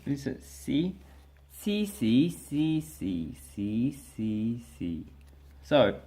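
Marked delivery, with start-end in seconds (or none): 1.5-5.1 sliding C across six pitches; six tones. Shown as a demonstration. Teacher demonstrates the six Cantonese tones on C, pitch sliding. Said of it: "if you can see here".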